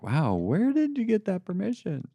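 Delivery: high-pitched mock incredulity; feigned, high-pitched astonishment; mock amazement. Mock challenge to the offended.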